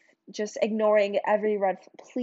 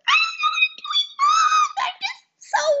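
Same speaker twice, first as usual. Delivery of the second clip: much higher, louder, breaking wail; crying wail; 5 dB louder than usual. Retold or imitated. Telling viewers how she begged her abusive boyfriend, wailing. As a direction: cry it out like you're desperate. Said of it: "And I'm just like".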